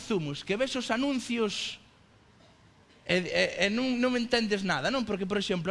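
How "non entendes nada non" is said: usual speaking voice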